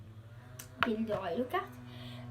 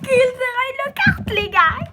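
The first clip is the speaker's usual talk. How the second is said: shouted slow and high with delight; Gilderoy Lockhart, drawn out in a loud fangirl squeal; thrilled; 10 semitones higher, 13 dB louder than usual. Real, not acted. Youtuber telling viewers her first pop, loud slow excited shout.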